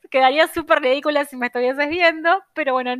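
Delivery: giggling at herself; laughing. Real, not acted. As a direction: laugh through the words. Laughs at own silly pose.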